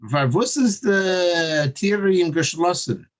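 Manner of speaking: put-on slow, high Yiddish-German accent; an old man asking in a thick Yiddish accent. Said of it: "in a very thick Russian and German and Yiddish accent".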